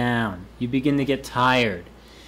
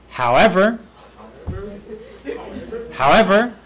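however with a high rising lift; 4 semitones higher than usual. Shown as a demonstration. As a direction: lift however up to grab attention. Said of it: "you gotta say it the right way".

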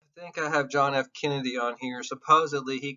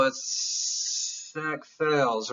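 slow drawn-out claim; much slower than usual. Shown as a demonstration. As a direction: stretch "it's sex sells" out slowly and firmly, like a slogan. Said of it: "Sex sells, or does it?".

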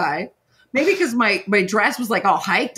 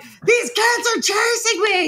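much higher, shouted plea; frantic shout; 11 semitones higher than usual. Retold or imitated. Rider yelling to a passing cop, frantic high shout. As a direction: call out desperately for help. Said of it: "I'm like".

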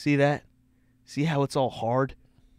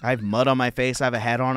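ordinary talk, nothing marked

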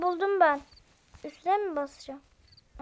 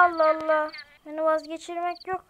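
normal talking voice

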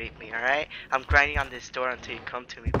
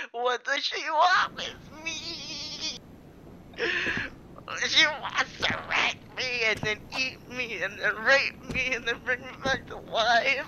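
high mock wail; 8 semitones higher than usual. Real, not acted.

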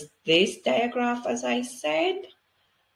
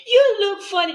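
put-on high, loud kid's giggly line; child voice; 13 semitones higher, 10 dB louder than usual. Child teasing the teacher about her dance. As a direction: squeak it like a laughing child. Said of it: "And they say".

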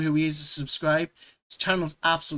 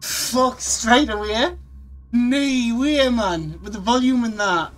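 shaken exclamation; 6 semitones higher, 5 dB louder, slower than usual. Real, not acted. Reacting to the jump scare.

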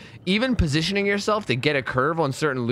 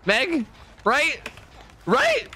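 rising, ever higher pleading rights; 7 semitones higher than usual. Pretend. Gamer teasingly pleads with a survivor Meg, pitch climbing higher. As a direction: beg her to admit it, voice climbing.

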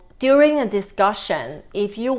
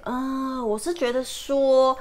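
hesitant softener; 5 semitones higher, 4 dB quieter than usual. Retold or imitated. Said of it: "Taiwanese people say".

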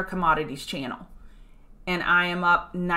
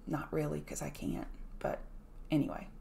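quiet aside; 5 semitones lower, 13 dB quieter than usual. Real, not acted. Self-deprecating aside.

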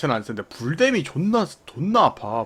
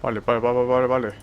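ordinary delivery

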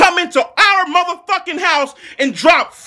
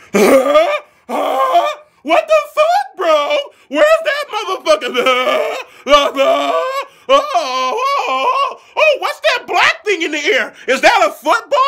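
mock dumb defender; 3 semitones higher than usual. Mocking confused defenders.

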